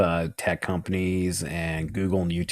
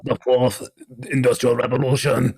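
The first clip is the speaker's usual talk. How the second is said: higher, louder super-villain voice; Klaus; 5 semitones higher, 4 dB louder than usual. Acted. Guest showing off his Klaus for the co-host, villainous impression. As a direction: proclaim it like an over-the-top movie villain. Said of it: "I'm trying to do Klaus".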